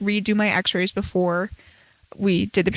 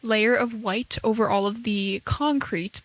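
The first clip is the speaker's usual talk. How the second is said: ordinary talk, nothing marked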